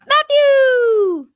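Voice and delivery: calling the name out high and loud; host's loud, high, excited hello to a caller; excited greeting. Real, not acted. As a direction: greet the caller with a big cheer.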